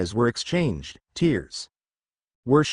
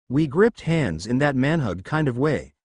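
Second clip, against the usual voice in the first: plain reading voice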